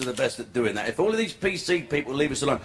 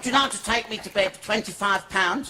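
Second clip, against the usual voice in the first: high put-on woman's voice; prostitute's proposition; 5 semitones higher than usual. Prostitute offers Chalky a price, high put-on voice. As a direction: lift it into a comic female pitch.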